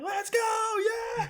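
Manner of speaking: high, loud hype holler; whooping shout of celebration; retold restaurant yell. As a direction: act out cheering across a restaurant. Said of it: "We were, like, yelling in the restaurant".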